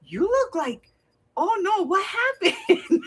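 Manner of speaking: high, slow, laughing cashier voice; a touch of alarmed sympathy; worried cashier. Retold or imitated. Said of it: "She goes".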